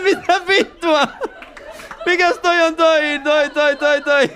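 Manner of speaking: high, loud slow mock astonishment, laughing; mock amazed. Comedian as the passenger, high and loudly amazed. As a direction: gasp it out like a kid. Teller reacts in story.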